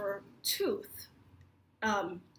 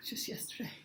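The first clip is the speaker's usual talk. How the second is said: whispered aside; 9 dB quieter than usual. Real, not acted. Whispered aside about moving.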